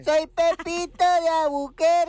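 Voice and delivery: very high, drawn-out joke voice; Pepito. Acted. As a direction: squeal the introduction slowly in a put-on falsetto. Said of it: "Saluda al Pepito". (vocecita fingida muy aguda)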